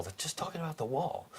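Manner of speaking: can't believe what you're hearing; incredulous. Real, not acted. Complaining to listeners about the wall, high disbelief.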